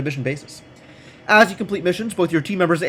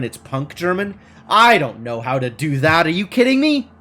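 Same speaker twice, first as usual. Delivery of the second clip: normal talking voice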